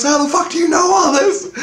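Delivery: high, loud hammed-up yell of disbelief; mock panicked shout. Imitating the girl he met freaking out at him, mock shriek. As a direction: play her panic, shrieking it out. Girlfriend panics at UFO vanishing.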